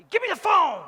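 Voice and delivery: yelled high and loud, far from gentle; ironic shout. Retold or imitated. Husband yelling at wife lost on mountain roads, loud. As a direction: bark the demand at her. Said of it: "That was my gentle voice".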